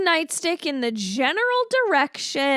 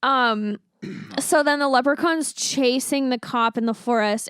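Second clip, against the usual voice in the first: usual speaking voice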